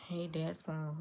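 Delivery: ordinary talk, nothing marked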